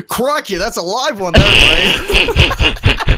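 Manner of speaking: loud, high mock-Aussie voice; Australian accent. Gamer asked to do Australian at a lively foe, hammed-up Australian accent. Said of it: "do a fucking Australian accent".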